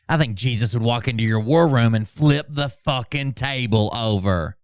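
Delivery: ordinary delivery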